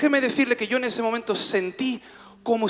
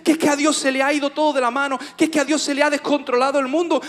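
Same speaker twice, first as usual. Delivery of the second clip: loud, high sermon delivery; preaching; 7 semitones higher, 7 dB louder than usual. Real, not acted. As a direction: proclaim it to the congregation, drawing out key words. Preaches at full force. (predicación enérgica, voz alta y aguda)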